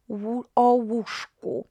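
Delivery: "o łóżku" said slowly and clearly; slow model phrase. Shown as a demonstration. Teacher giving the full locative phrase, slow. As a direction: stretch "o łóżku" for learners. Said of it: "So about a bed".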